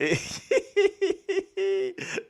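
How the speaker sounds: high-pitched giggle burst; high laughter. Real, not acted. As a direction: giggle uncontrollably. Laughing fit. (fou rire aigu)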